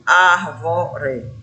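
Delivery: "árvore" in separate syllables, loudest on "ár"; "árvore" sounded out from a strong "ár"; stress on first syllable. Shown as a demonstration. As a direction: punch the "ár" of "árvore", then ease off. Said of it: "a sílaba ar".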